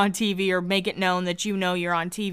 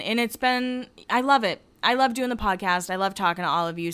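usual speaking voice

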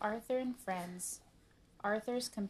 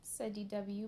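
usual speaking voice

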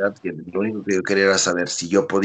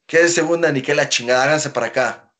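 angry retort; 7 semitones higher, 4 dB louder than usual. Retold or imitated. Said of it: "se enojó".

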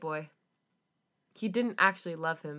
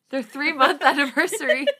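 cracking up mid-phrase, louder; can't keep a straight face reading it; laughing; 6 semitones higher, 12 dB louder than usual. Real, not acted. Reader laughing at the silly line while reading aloud. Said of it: "That part's a little unserious".

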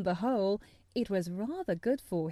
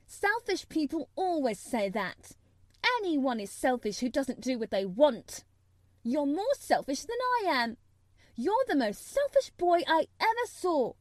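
higher-pitched, argumentative; heated accusation; 6 semitones higher, 3 dB louder than usual. A narrator's reading. Mary calling Colin selfish, heated. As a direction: build the accusation to a peak.

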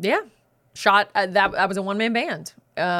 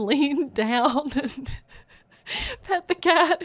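laughing through the words, higher and slow; real laughter; 7 semitones higher, much slower than usual. Real, not acted. Sister cracks up telling brother's cat story, laughing. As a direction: struggle to get it out through laughter.

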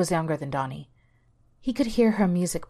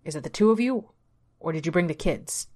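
demanding. A narrator's reading. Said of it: "Carl demanded".

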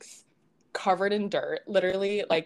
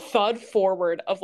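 ordinary talk, nothing marked

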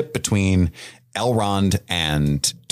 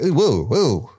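higher, hammed-up ghostly woo; mock spooky ooh; 6 semitones higher, slower than usual. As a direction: wave it like a silly haunting. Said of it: "So Elrond's like".

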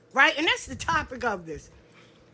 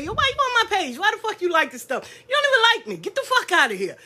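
higher, laughing petty rant; mock-annoyed, much higher; petty clapback; 6 semitones higher, faster than usual. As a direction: let the petty side out. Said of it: "The petty me be wanting to say".

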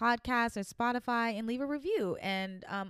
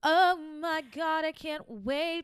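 excited; 6 semitones higher, 5 dB louder, slower than usual. Real, not acted. Excited about musical theater.

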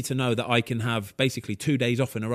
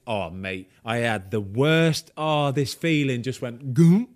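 ordinary talk

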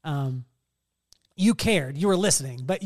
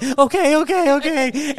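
caving okay; 10 semitones higher, 12 dB louder than usual. Retold or imitated. Giving in to brother.

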